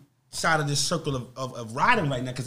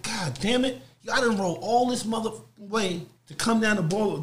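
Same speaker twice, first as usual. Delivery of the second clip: frustrated; 8 semitones higher than usual. Retold or imitated. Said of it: "So now I'm like".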